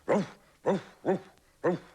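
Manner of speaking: high, loud barking; dog bark. Acted. Dog barking at the ducks, loud barks. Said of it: "thought the dog".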